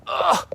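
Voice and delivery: high, loud grunt of exertion; effortful grunt. Real, not acted. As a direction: grunt with the effort of a steep climb. Struggling on a steep bank.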